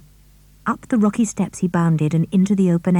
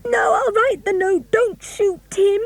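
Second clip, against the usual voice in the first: high, slow, frantic begging; girl pleads with a gunman to spare her dog, frantic; screamed plea; slower than usual. A narrator's reading.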